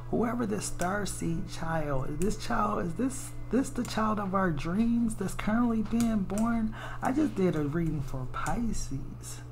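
ordinary delivery

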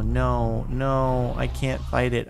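ordinary talk, nothing marked

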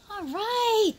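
high excited exclamation; high excited all right. Real, not acted. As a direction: cheer the moment on. Excited at dogs meeting.

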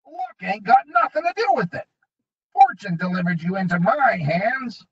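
high, gloating goblin voice. A narrator's reading.

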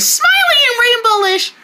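very high sing-song cutesy voice; high gushing. Acted. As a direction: squeal it sweetly, up high. Gushes about keeping Beds happy.

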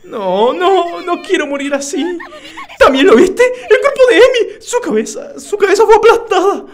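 high, loud, hammed-up sobbing panic with laughs; mock terrified. Gamer voicing a girl who found a crushed body, mock sobbing. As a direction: play the scared girl crying, half laughing at yourself.

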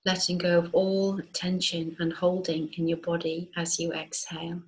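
calm, unhurried meditation voice with long pauses; guided meditation. Acted. Teacher guiding listeners to feel grounded and rooted, guided meditation. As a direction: guide them gently into rest, leaving space between phrases. Leads a yoga nidra meditation.

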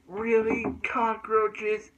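put-on high, loud grossed-out little girl; disgusted girls. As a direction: squeal it like a disgusted superhero girl. Powerpuff Girls voiced, disgusted.

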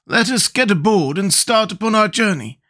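high-pitched character voice; Scarecrow's voice. A narrator's reading. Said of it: "he added, turning to the others".

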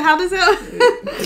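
slow words broken by laughter; laughing. Real, not acted. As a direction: laugh through the question. Laughing mid-question at the scale.

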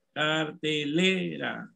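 cartelera slow, with LE drawn out; stressed syllable shown. Shown as a demonstration. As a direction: stretch the "le" in cartelera. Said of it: "¿Cuál es la sílaba tónica?".

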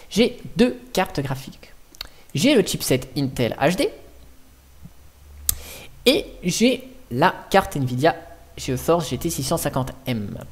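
ordinary talk

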